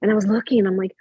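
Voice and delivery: ordinary delivery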